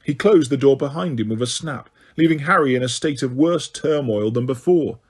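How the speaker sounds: normal talking voice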